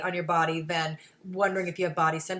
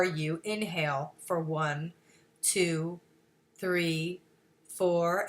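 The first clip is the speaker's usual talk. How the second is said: low, slow count for an inhale; slow breath count; 3 semitones lower, much slower than usual. Shown as a demonstration. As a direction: count evenly with long gaps, guiding the breath.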